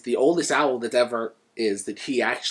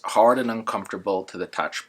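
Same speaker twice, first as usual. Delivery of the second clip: ordinary talk, nothing marked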